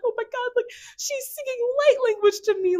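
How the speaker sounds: very high, thrilled squeal; excited. Retold or imitated. Dreamer reliving light language sung to her, excited squeal. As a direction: squeal it in starstruck amazement. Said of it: "I just got so excited".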